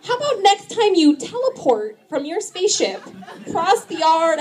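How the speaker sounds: sing-song reasoning parent; patient mom. Retold or imitated. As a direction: humor the kid with sweet patience. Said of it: "And I was like".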